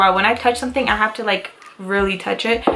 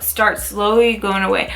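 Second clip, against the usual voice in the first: usual speaking voice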